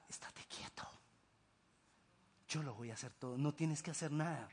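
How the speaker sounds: soft divine whisper. Acted. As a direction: whisper it like a secret promise. God's calm voice whispered.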